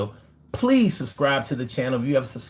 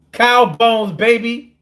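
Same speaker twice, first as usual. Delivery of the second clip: high, loud hype shout; hammed-up showman's brag; hyped catchphrase; 8 semitones higher, 6 dB louder than usual. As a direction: crow it proudly, high and loud. Showing off cow bone necklace.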